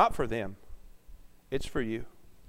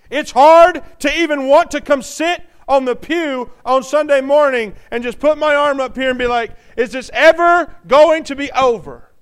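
loud, higher, driving preaching; preaching at full force; 7 semitones higher, 9 dB louder than usual. Acted. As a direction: hammer it home to the church.

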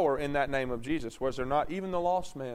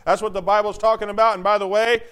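loud, raised scripture reading; full-force preaching; 6 semitones higher, 10 dB louder than usual. Acted. Preacher reading Revelation to the congregation, loud and forceful. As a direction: drive each verse home loudly.

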